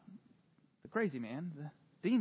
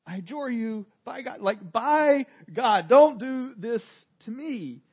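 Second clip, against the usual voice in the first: louder pleading, building toward by God; pleading demon; 5 semitones higher, 11 dB louder, slower than usual. Retold or imitated. Preacher voicing the demons begging Jesus, louder. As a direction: beg like a cornered demon. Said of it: "Kinda like".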